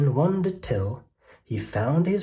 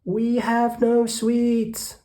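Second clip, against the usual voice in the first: very high, slow squeaky ant voice; tiny ants; 12 semitones higher, much slower than usual. Acted. Ants answering the rat, high and squeaky. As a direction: peep it out like frightened little ants. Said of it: "the ants replied".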